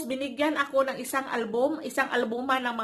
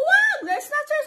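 high alarmed yell; calling out loud to stop a snatcher; shout; 5 dB louder, slower than usual. Retold or imitated. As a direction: yell for help at a thief. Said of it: "sumigaw ako".

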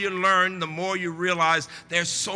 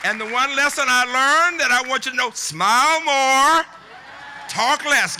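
rousing oratory; 5 semitones higher, 6 dB louder than usual. Real, not acted. Rousing lesson to applauding graduates.

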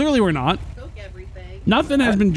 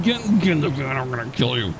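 mock menacing kidnapper; 11 semitones lower, 3 dB quieter than usual. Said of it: "he just said".

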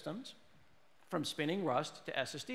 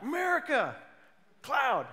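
high, loud, dumb American shout; brash American holler; 8 semitones higher, 5 dB louder than usual. Acted. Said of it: "But I come out and I'm like".

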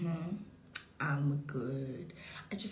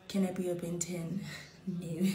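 ordinary delivery

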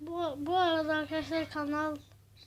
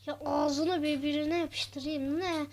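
usual speaking voice

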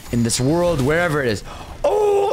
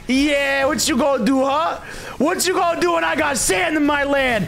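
taunting shout; 10 semitones higher than usual. Real, not acted. Taunts the enemy loudly.

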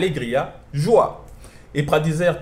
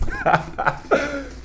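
high giggle at own memory lapse; short burst of embarrassed laughter; real laugh; 14 semitones higher than usual. Real, not acted. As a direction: laugh off forgetting a word. Laughs at forgetting the Portuguese word.

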